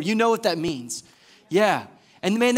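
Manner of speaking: usual speaking voice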